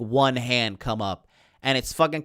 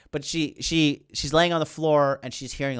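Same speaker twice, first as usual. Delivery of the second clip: ordinary talk, nothing marked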